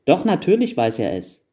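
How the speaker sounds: lightly voiced insistent kids, pitch leaping; children calling out. A narrator's reading. Other children urging the smallest to speak, calling out. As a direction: egg a shy sibling on. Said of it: "riefen die anderen Kinder".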